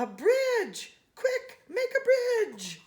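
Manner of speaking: high, laughing, eager shouts; excited laughing cry. Real, not acted. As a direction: squeal it out with delight. Child reacts to monkey bridge.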